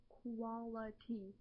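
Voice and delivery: Quality, said slowly and carefully, syllable by syllable; slow fix of Quality; slow careful word. Shown as a demonstration. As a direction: spell out Quality slowly after stumbling. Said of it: "There we go".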